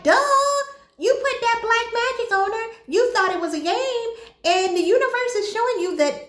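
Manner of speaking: high, exasperated "duh" tone; mock scolding. Viewer scolding Bear for using magic, exasperated.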